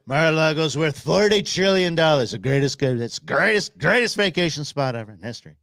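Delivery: lightly voiced boastful Trump; a hint of bragging Trump; mild Trump bluster; Trump impression. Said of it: "that Donald Trump said".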